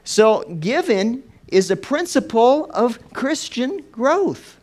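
loud, high preaching voice. Acted. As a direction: preach it out with full force. Preaching at full force.

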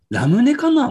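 high, loud sudden question; surprised. Real, not acted. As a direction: blurt out the guess. Sudden guess.

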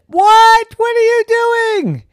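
high, loud shocked what; startled mom. A narrator's reading. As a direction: catch her in the act, shocked.

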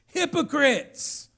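loud, drawn-out shout; exclaimed rebuke. Retold or imitated. Preacher quoting Jesus to the church, exclaimed rebuke. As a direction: call it out slowly at full volume. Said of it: "It's got an explanation point".

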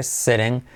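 sitting with the tt as a tapped r and the i like e. Shown as a demonstration. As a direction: say sitting as seh-ring with a flapped t. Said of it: "T como R".

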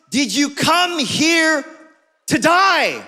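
taxi driver repeating it slow and firm; slow angry repeat. Acted. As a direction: spell it out slowly, fuming. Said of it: "he repeats it again".

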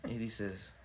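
ordinary delivery

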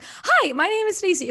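high, chirpy hi with a bright lilt; mock peppy self-introduction; bubbly greeting. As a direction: sing it out like a hyper bubbly girl. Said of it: "my sister always makes fun of me".

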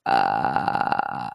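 low creaky fry sound; vocal fry. Shown as a demonstration. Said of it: "I love the Vocal Fry".

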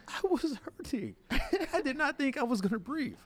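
high, whining retold complaint; whiny protest. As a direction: plead your case in a high whine. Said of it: "I said".

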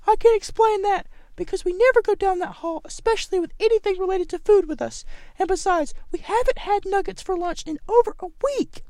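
shrill-sounding lunch lady insisting she heard nothing. Acted. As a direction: pitch it way up as the cafeteria lady. Lunch lady defends herself.

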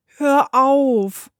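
high, loud plea; pleading. A narrator's reading. Mirko begs Mechthild to stop pelting him, pleading. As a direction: beg for it to stop. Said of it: "flehte Mirko".

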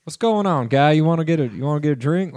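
high, loud goofy greeting; exaggerated cartoon intonation. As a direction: boom it out like a cartoon pizza guy. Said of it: "Sounds like a cartoon character".

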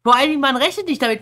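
ordinary delivery

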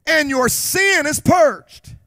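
loud, high preaching in rising fervour; full-force preaching. Acted. Preacher proclaiming God's grace to the church, loudly. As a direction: drive the grace home to the church.

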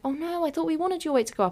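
high-pitched retold dismay; imitated concerned friend. Friend confused by her tears, imitated high-pitched concern. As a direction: voice the worried friend, pitched well up.

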